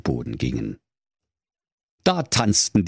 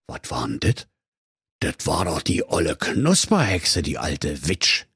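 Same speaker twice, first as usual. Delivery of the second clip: low, muttering wolf; 5 semitones lower than usual. Acted. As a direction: grumble about the old witch. Wolf spots the witch.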